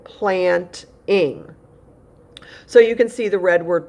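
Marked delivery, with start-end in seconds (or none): 0.0-1.5 "planting" in two separate beats, plant, ing; split into syllables. Shown as a demonstration. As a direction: break "planting" before the -ing suffix. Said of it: "it's making an extra syllable".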